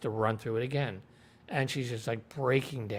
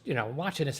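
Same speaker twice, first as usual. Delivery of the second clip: normal talking voice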